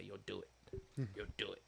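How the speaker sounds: very soft, low prompting; imitated voice in the head. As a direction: murmur it low, like an inner urge. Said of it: "something in my brain that used to say".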